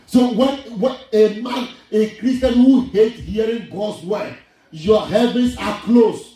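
high-pitched preacher's warning; emphatic preaching. Real, not acted. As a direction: warn the congregation with insistence.

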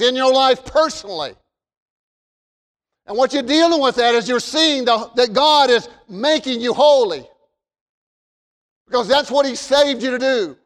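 ringing pulpit declaration; preaching. Real, not acted. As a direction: preach it out to the congregation. Preaches with raised, steady projection.

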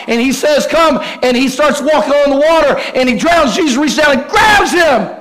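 high, driving sermon crescendo; full-force preaching. Real, not acted. Preacher retelling the walk on water, at full force. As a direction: raise the pitch and drive every phrase to the congregation.

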